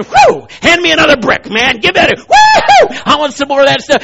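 fired-up worker. Retold or imitated. Preacher voicing a zealous wall builder, loud and eager. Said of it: "he must have been".

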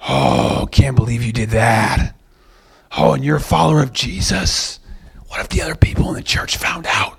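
breathy, whispered mock horror; hushed accuser, aghast; maximizing. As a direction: gasp it in a scandalized stage whisper. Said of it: "he maximizes it".

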